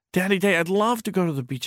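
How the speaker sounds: high, fast gush of delight; high excited child; eager little boy. Acted. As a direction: leap at the offer like a thrilled kid. Boy answers father's beach offer.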